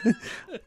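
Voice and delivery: short high laugh. Real, not acted. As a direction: burst out laughing. Laughs after mock accent.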